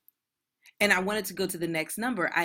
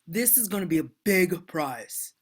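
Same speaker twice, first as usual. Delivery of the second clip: Donald Trump. Acted. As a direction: play the boastful showman, punching the big words. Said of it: "Trump impression".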